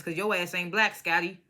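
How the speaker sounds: usual speaking voice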